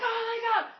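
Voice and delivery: high-pitched panicky cry; mock freaking out. Dreamer sees a zombie attack Jack, hammed-up freaking out. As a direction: play up the panic, voice shooting up. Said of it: "I'm freaking out".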